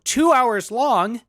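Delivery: loud, high, drawn-out disbelief; incredulous viewer. Retold or imitated. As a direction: balk at it, stretching each word out. Acting out put-off random viewer.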